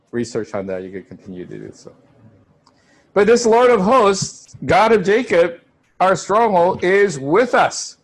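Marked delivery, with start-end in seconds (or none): 3.1-8.0 slow, louder and higher proclamation; slow ringing pulpit declaration; proclaiming. Real, not acted. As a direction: declare it to the whole congregation. Preacher proclaims God's presence.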